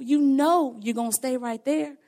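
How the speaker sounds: usual speaking voice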